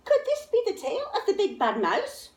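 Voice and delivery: put-on high, wondering child voice; curious monster child. A narrator's reading. Gruffalo's child wondering about the tail, high and curious. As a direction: peer at the tail, half hoping.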